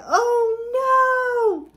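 high, stretched storybook alarm; dramatic alarm. Acted. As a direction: draw out a high, frightened oh no. Girl wakes facing three bears.

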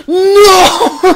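high "No!" breaking into laughter; laughing no. Real, not acted. Reacting to a game blunder, laughing. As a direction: crack up at the mistake.

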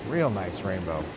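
normal talking voice

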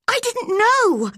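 very high, panicked cry; frantic, pitch shooting right up; screamed. A narrator's reading. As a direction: shriek the denial in distress. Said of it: "she screamed".

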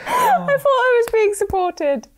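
high, slow, wounded whine; mock hurt. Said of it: "mock hurt".

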